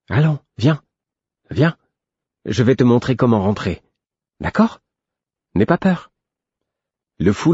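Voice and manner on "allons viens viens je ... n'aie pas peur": higher, lilting reassurance; kindly, singsong encouragement; gentle coaxing. A narrator's reading. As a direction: coax a scared creature to come along. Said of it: "prendre un ton doux et rassurant".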